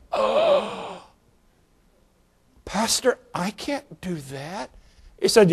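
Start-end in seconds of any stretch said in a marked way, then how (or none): 0.1-1.0 shocked gasp. Retold or imitated. Old woman shocked by pastor. 2.6-4.7 put-on hushed, frail old widow protesting; timid old lady. Widow refusing her pastor, small hushed voice.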